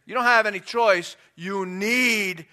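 loud, insistent, stretching the final command; forceful exhortation. Acted. Preacher looking at the audience, forceful exhortation. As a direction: exhort them with no room for choice. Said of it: "look at the audience and say".

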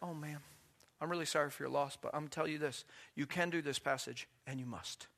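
hushed condolence turning to quiet insistence; gently firm. Retold or imitated. Preacher answering a grieving widow, gently firm. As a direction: keep it very soft but unbending.

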